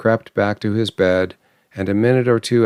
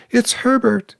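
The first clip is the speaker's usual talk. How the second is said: scream. A narrator's reading. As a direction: shriek it with wild hope. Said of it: "she screamed".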